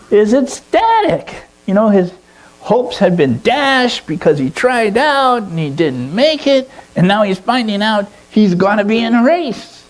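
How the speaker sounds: high-pitched, excited narration; ecstatic storytelling. A narrator's reading. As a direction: tell it swept up in the boy's joy. Narrating Peter's ecstatic reaction.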